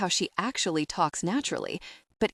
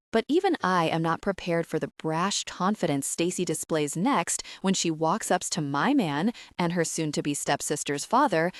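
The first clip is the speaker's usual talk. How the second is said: plain reading voice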